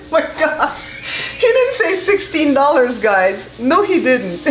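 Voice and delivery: talking through real laughter; high, giggling disbelief; laughing. Real, not acted. Laughing at the snake price.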